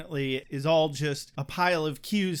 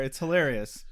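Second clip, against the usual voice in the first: usual speaking voice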